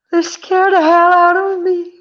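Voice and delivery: high, tense, frightened; pitched far up in real fear; scared. Real, not acted. Hospital patient scared by staff, high and frightened.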